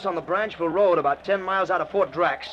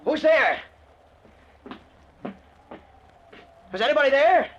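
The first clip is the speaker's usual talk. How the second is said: nervous calling out; 7 semitones higher, 4 dB louder than usual. Acted. Startled man calls out.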